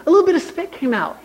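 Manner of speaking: can barely get it out for laughing. Real, not acted. Laughing while retelling friend's gross story.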